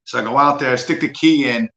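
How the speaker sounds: normal talking voice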